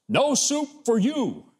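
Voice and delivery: high, drawn-out mock refusal; abrupt soup man. Soup man refusing a customer who held up the line, abrupt. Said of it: "he would hear the abrupt response".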